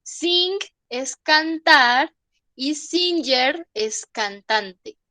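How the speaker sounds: teacher recapping the difference; slow teaching recap. Shown as a demonstration. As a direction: lay out both words slowly. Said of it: "Son cosas diferentes".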